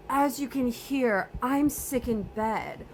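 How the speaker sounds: mock sick voice, slow and feeble; faking sick. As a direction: play the poorly patient, slowly. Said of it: "playing sick".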